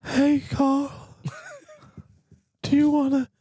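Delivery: creepy-friendly in his light voice; killer impression.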